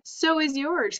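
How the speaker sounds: high, gleeful retort; cried back. A narrator's reading. Avery teasing Fern back, high and gleeful. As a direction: toss it right back up high. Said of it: "cried Avery".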